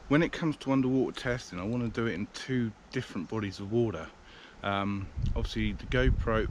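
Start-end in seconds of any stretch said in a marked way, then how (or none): none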